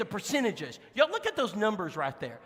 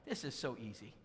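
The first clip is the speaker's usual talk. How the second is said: normal talking voice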